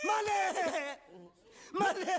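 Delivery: drawn-out, high wailing call; hammed-up anguished howl; mock-tragic wailing. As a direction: cry out, stretching the name.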